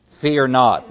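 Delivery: "Fear not" punched out loudly; emphatic command. Acted. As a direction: hit both words of "Fear not" hard. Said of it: "That's emphatic".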